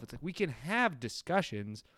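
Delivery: ordinary talk, nothing marked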